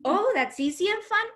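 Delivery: high, wondering lightness; delighted realisation. Retold or imitated. Teen repeating the tutor's words, high and delighted. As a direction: echo it like a happy discovery. Speaker's own changed outlook.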